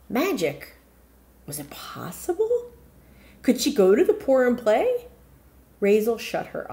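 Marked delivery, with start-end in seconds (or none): none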